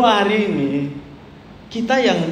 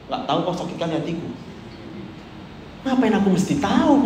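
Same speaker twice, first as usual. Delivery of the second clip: ordinary delivery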